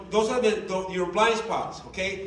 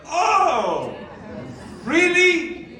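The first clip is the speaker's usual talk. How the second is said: louder, higher incredulous rebuke; incredulous scolding; 6 semitones higher, 4 dB louder, slower than usual. Acted. Leader scolding partners who stayed home, loud disbelief. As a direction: scold them for skipping work. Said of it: "scolds partners, acting boss".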